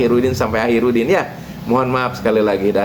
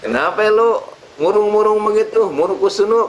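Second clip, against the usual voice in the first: teasing kiai; 8 semitones higher than usual. Acted. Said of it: "sambil ngeledek".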